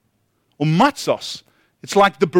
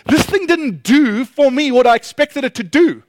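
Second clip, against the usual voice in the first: mock complaint; 7 semitones higher, 3 dB louder than usual. Acted complaint after disappointing vacation.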